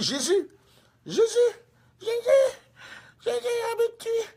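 very high hammed-up screams; mock terrified cry. Preacher imitating evangelist attacked by demon, terrified cries. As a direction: squeal for help like a victim. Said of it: "l'évangéliste crie".

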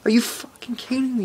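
high, furious disbelief at the brother; retold angry disbelief. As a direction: hurl the question at him in outrage. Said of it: "I was, like, swearing".

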